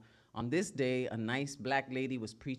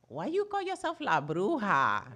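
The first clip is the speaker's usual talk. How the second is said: a touch of scolding abuelita, higher; grandma voice; 6 semitones higher, 4 dB louder than usual. Retold or imitated. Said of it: "she had the nerve to ask me".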